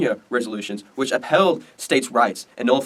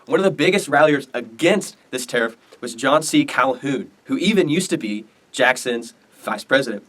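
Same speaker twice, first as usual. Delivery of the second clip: usual speaking voice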